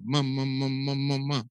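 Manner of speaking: soft, fading ma-ma-ma babble; baby babbling. Shown as a demonstration. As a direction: babble gently like an infant.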